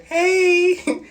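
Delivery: high hey with a crying laugh; high giggly hey. Real, not acted. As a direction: sing out a giddy little hey. Waves at camera, laughing.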